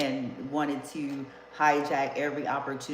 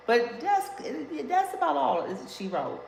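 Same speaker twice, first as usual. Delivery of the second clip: playful high; 9 semitones higher than usual. Pretend. Playful sign-off after laughing.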